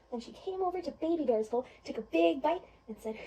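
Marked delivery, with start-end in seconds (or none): none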